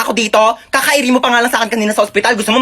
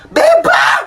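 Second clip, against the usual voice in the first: high loud shout of "Bebang!"; shouted call; 3 dB louder than usual. Acted. Driver calling Bebang, shouting her name loud. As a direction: yell "Bebang!" high across the road.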